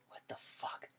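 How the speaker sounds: breathy whispered disbelief under his breath; shocked whisper. Retold or imitated. Recruit spotting a giant roach, whispering in shock. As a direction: hush it out like you can't believe your eyes. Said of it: "I'm like".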